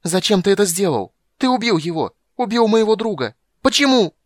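high, loud piercing cry; shrill shout. Acted. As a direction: scream it at him in anguish. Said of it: "закричал громко и пронзительно".

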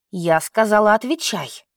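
ordinary delivery, nothing marked

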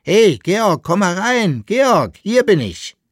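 loud, high call across the street; hailing a friend from the garden, loudly. Acted. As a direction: shout to him to come in. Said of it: "Er rief ihn an".

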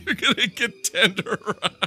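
high-pitched, fast, through real laughter; laughing. Real, not acted. Friend cracking up over a kitchen fight, laughing. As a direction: crack up while talking.

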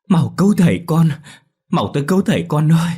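high, loud, panicked wail down the telephone; frantic cry for help. A narrator's reading. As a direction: scream for the children to come at once. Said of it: "Tiếng bà cụ Mận vang lên".

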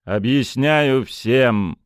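slow, hard metallic voice; booming metallic robot tone. A narrator's reading. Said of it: "металлическим голосом".

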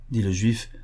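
ordinary delivery, nothing marked

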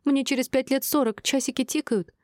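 high-pitched, upset complaint; understated tearful protest; tearful yell. A narrator's reading. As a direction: plead it in a high voice, near tears. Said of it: "сквозь слёзы заорала Шинар".

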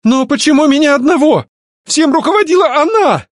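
very high, loud accusing shout. A narrator's reading. Alfred blames her for leading everything, shouting. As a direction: cry out in shrieking protest. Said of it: "вдруг опять закричал Альфред".

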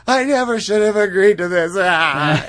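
loud, high mock cry of regret; over-the-top loud lament; hammed-up distressed wail. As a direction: wail it melodramatically. Date regrets meeting, acted out.

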